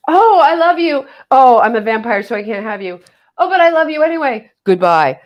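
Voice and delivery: high, hammed-up swooning lovers' lines; mock melodramatic romance. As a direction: overplay the teen vampire romance for laughs. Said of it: "And it was like".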